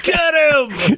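high mock cheer; excited cheer. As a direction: egg him on, high-pitched. Kids cheering on a fight.

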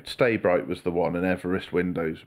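normal talking voice

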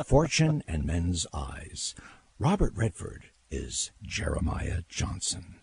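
slow, hushed film announcer; movie trailer voice. Shown as a demonstration. Former trailer announcer demonstrating his old work, trailer voice. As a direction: draw out each title, close to the mic. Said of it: "Let's hear the [name] trailer voice".

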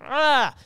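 loud frustrated ah; exasperated shout. Acted. Frustrated outburst after acting out the fight. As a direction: blurt out an exasperated cry.

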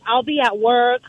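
ordinary talk

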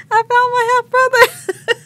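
very high, loud squeal of joy; retold joyful screaming. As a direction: shriek the happy news to everyone around. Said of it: "I'm, like, screaming".

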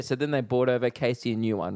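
normal talking voice

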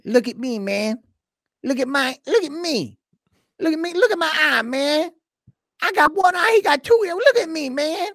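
hammed-up boxer with a lisp, squeaky and pushy; Mike Tyson impression. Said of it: "if you keep doing that impression".

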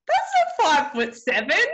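high, loud laughing quote; laughing tease. Retold or imitated. Husband laughing at wife's height claim, laughing. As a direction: say it through laughter.

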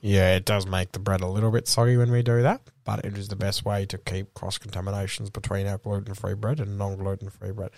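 low, measured corporate spiel; infomercial voice. Acted. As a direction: explain it like a Subway ad. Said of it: "are you doing it like an infomercial on Subway".

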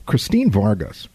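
normal talking voice

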